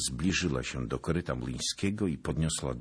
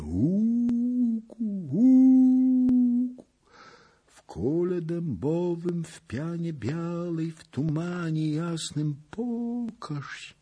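very high, drawn-out wailing chant; long hooting calls into slow high conjuring; witch's incantation; 7 dB louder, much slower than usual. A narrator's reading. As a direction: wail the spell slowly, like a summons. Witch repeats her conjuring chant.

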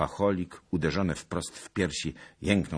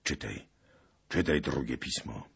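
hushed hoarse voice; 2 semitones lower than usual. Acted. Bohun ordering Zagloba to read, hushed and hoarse. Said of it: "przyciszony, chrapliwy głos".

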